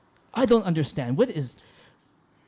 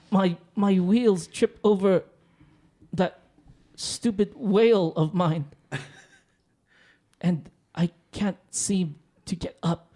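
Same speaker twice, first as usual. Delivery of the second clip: usual speaking voice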